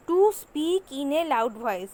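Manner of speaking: slowly, word by word; slow dictation. Shown as a demonstration. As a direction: say it slowly enough to write down. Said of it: "আমি আস্তে আস্তে বলছি". (ধীরে ধীরে, লিখে নেওয়ার মতো করে)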